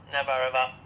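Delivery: usual speaking voice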